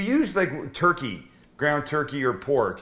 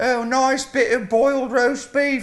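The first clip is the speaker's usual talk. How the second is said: much higher, slow, put-on English accent; hammed-up British accent, much higher; Monty Python English accent; 12 semitones higher, 5 dB louder than usual. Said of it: "that's my standard English accent".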